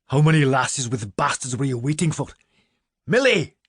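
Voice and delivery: sharp scornful jab rising to a loud cry of Milly; indignant rebuke. Acted. Minerva rebuking the priest, then calling Milly sharply. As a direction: cut him down, then call the girl.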